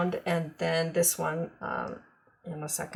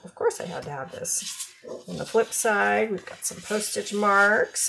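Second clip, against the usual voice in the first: normal talking voice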